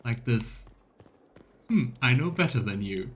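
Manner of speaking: ordinary delivery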